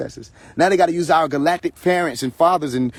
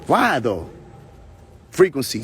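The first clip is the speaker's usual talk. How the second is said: normal talking voice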